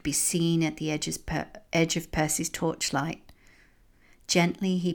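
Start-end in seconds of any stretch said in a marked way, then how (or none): none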